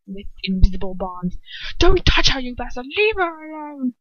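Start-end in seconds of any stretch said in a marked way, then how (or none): 1.8-4.0 desperate yell. Acted. Ron yells at captor.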